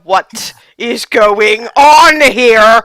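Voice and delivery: loud, slow, booming demand; angry Goliath. Acted. Goliath confronts the intruder, loud slow demand. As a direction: boom it out as a looming giant.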